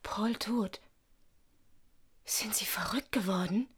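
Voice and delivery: shocked whisper. Acted. Jeanne hears of Paul's death, shocked whisper. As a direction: gasp it out barely voiced. Shocked reaction to murder news.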